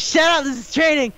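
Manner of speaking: high-pitched retold outburst; mock-outraged coaching; acted indignant yell. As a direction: yell it like a worked-up trainer. Acting out trainer silencing onlookers.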